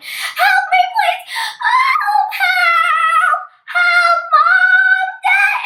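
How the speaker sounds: loud, very high mock cries for help; high-pitched croaky screaming. Lost girl screaming for her parents, high croaky voice. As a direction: call desperately, hammed up. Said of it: "with a really high-pitched croaky voice".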